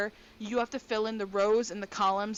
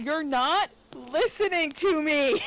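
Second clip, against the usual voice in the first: louder, higher, fed-up cry; exasperated yell; 5 semitones higher, 5 dB louder than usual. Real, not acted. To her boyfriend, fed up: exasperated yell. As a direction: shout it at him, exasperated. Frustrated outburst in argument.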